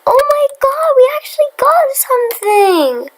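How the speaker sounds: high, loud thrilled squeal; excited squeal. Real, not acted. Gamer thrilled by her first trade, squealing high and loud. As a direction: shriek with joy at the first trade.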